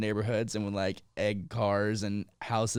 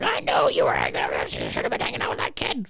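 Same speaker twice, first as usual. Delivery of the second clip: low, rasped mock scolding; scolding mom impression; 11 semitones lower than usual. His mom reproaching him over that kid, rasped. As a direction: rasp it out as a disapproving mom. Retells own mom's reproach.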